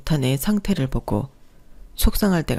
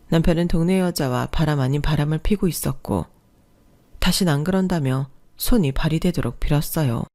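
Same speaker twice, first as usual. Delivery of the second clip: ordinary talk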